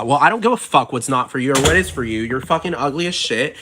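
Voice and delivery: ordinary talk, nothing marked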